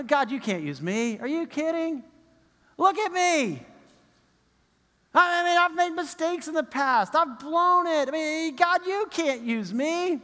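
a believer makes excuses to God, high whiny pleading; excuse-making believer. Retold or imitated. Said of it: "We offer every excuse".

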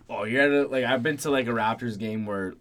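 ordinary talk

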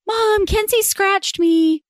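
high, drawn-out tattling whine; little boy complaining. Retold or imitated. Son tattling to mom about a scratch, childish whine. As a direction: imitate a little kid's slow, high complaint. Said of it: "my little guy comes upstairs and he says".

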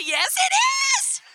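high, slow laughing shout; thrilled laughing yes; elated. Retold or imitated.

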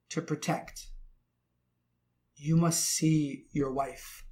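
normal talking voice

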